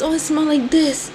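ordinary talk

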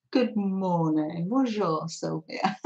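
French greeting in a clumsy English accent; terrible French accent. Real, not acted. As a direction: attempt French with a poor accent, softly. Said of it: "Terrible accent".

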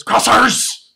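CROSSERS barked high and loud, hard R; sudden loud "Crossers" with an exaggerated R; trained hard R. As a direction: bark "Crossers" like a drilled field call. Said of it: "That's how they train us".

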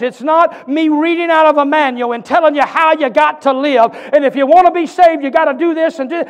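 loud, high, driving sermon voice; preaching at full force. Acted. Pastor urges Gateway Church to hear him, full-force preaching. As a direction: preach it out to the whole room.

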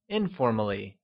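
ordinary delivery

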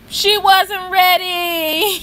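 high, slow, teary mock wail; fake-cry through a laugh at the insult. Gossip vlogger mock-sobbing for Cynthia, slow and high.

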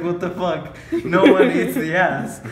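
acted-out indignant grandma; retold incredulous retort. Grandma answering her grandson's odd demand, mock incredulous.

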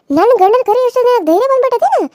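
high, loud angry shout; jealous wife shouting in rage; furious outburst. Acted. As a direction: blast her for calling the husband. Villain furious she calls husband.

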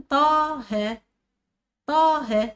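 "طه" slowly, with a long aa; sounded alif. Shown as a demonstration. Teacher asking which letter is hidden, "طه" with long drawn vowels. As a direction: draw out the unwritten alif in "طه". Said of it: "الألف".

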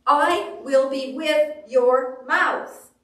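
slow, loud, emphatic promise; big deliberate pronouncement, drawn out; God's declaration. Acted. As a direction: proclaim it word by word as God. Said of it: "And he says".

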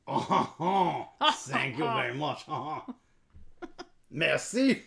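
hammed-up French accent with chuckles; put-on French accent; weird French voice. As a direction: play a cartoonish Frenchman giving thanks. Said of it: "you get to talk in a weird voice".

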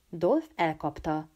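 ordinary delivery, nothing marked

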